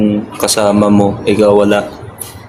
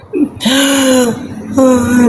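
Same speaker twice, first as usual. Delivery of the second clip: a burst of high-pitched giggling; giggling at a comment; high laugh. Real, not acted.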